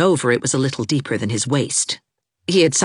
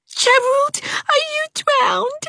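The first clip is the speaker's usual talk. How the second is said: put-on high child's cry; high, anxious girl's cry; cried out; slower than usual. A narrator's reading. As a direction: cry out as a frightened little girl. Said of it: "cried Geraldine".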